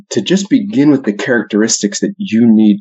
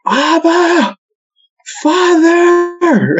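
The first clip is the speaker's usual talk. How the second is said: high, drawn-out yelled prayer; yelling out; much slower than usual. Retold or imitated. Priest calling to God over speakerphone, yelling out. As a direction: cry out high, holding each word long. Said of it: "he, he yells out".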